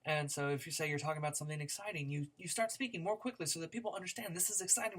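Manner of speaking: usual speaking voice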